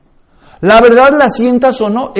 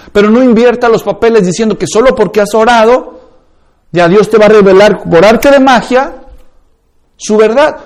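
ordinary delivery

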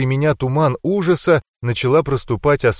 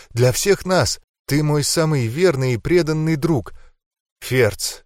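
ordinary delivery, nothing marked

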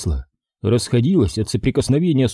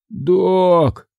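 slurred speech; 7 semitones higher than usual. Acted. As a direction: call out with a thick, slurring tongue. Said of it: "заплетающимся языком позвал".

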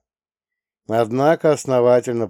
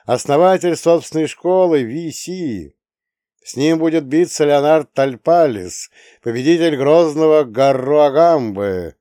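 arena announcer; 5 semitones higher, 5 dB louder than usual. A narrator's reading. Announcer introduces fighters.

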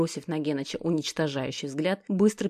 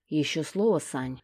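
ordinary delivery

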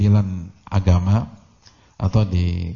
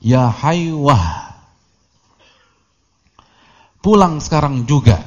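loud, raised call with drawn-out name; mother shouting; 5 semitones higher, 5 dB louder, slower than usual. Retold or imitated. Mother calls her teaching son home, shouting. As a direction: call your son out sharply, higher and louder. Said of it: "ibunya teriak".

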